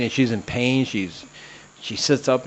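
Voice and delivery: ordinary talk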